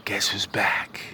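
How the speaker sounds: hushed, breathy teasing whisper; whispered, like sharing a secret. Acted. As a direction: lean in and whisper it. Whispered take after loud one.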